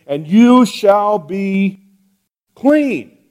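slow, with "clean" lifted high after a pause; drawn-out emphasis on clean. Shown as a demonstration. Preacher stressing the scripture's promise to the congregation, slow and emphatic. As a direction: lean on the word "clean", stretching the phrase. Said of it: "notice the phrase at the end".

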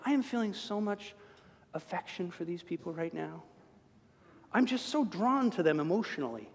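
soft, syrupy sarcastic gush; mock sentimental. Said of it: "Jesus is hanging there going".

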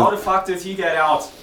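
exasperated outburst. Real, not acted. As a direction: blurt it out, loud and fed up. Friend blurts angry complaint on stage.

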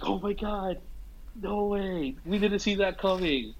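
high, thrilled mock crowd reaction; a mock crowd gasping with delight; excited crowd cheer. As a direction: cheer like the theater audience. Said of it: "the crowd cheered".

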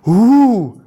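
mock spooky "ooh". Preacher waving off mystical stuff, mock spooky hoot. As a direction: mimic a spooky mystical wail.

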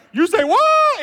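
very high and loud, hammed-up disbelief; stunned disbelief. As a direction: throw it out in mock shock. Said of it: "Nicodemus was freaking out".

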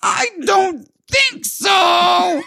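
high-pitched furious rant building to a shout; screaming homeowner. Acted. Homeowner berates worker over his seeded lawn, screaming. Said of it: "So he's screaming".

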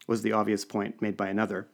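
ordinary delivery, nothing marked

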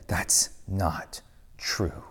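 whispered, very slow "That's not true"; soft firm denial. Acted. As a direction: breathe the denial slowly. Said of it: "it says again".

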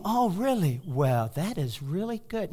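lightly mocking prim voice; hint of a prim churchy voice; imitating a suddenly pious passenger. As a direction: parody the sudden piety.

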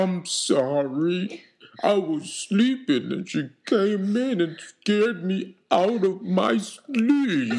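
very slow, drawn-out dragon voice; big creature apologising at a crawl; talking dragon. Acted. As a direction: stretch every word out like a lumbering beast.

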